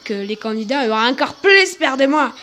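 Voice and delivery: put-on thick Marseille accent, endings drawn into "-ing"; Marseillais accent. Mia presents herself to the reality-show viewers, strong Marseille accent. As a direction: play a brash Marseillaise candidate with heavy accent. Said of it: "avec mon accent marseillais".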